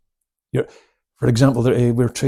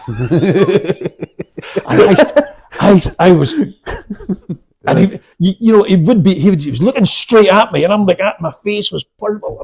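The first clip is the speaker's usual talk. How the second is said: laughing; 7 semitones higher, 6 dB louder than usual. Real, not acted. Laughing while retelling pain.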